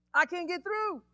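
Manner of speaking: high-pitched cry of being stuck; acted frustration. Preacher acting out being blocked by his problems, high frustrated cry. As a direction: play someone straining against a blockage.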